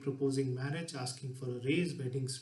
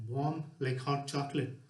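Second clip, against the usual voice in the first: normal talking voice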